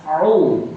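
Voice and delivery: Au lifting up and out; rising Au. Shown as a demonstration. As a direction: glide the vowel upward on Au. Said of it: "Naik ke atas".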